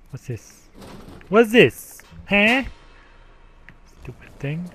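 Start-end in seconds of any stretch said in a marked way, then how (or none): 0.1-0.7 very soft, under the voice; quiet aside. Real, not acted. Player asking themselves during the game, quiet aside. Puzzled aside, then called out. 1.3-2.6 higher, much louder question; loud demand. Real, not acted. Player repeating the question to the game, loud demand.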